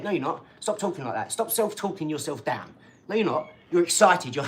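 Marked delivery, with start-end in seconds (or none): none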